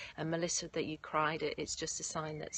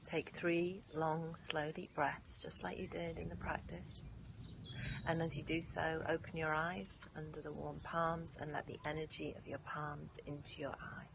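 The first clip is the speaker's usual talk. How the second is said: guided meditation; 9 dB quieter than usual. Acted. Leads yoga nidra meditation.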